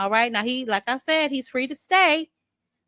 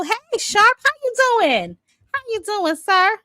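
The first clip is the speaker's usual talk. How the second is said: much higher, excited hello to a viewer; gushing greeting; 12 semitones higher, 6 dB louder than usual. Real, not acted. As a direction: sing out a delighted hello to a regular. Greeting chatter by name.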